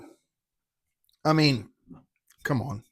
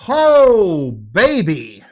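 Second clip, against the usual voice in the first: delighted exclamation; 3 semitones higher, 14 dB louder, much slower than usual. Real, not acted. Tasting the rye, overjoyed at how it coats. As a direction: savor it out loud. Savoring the whiskey's finish.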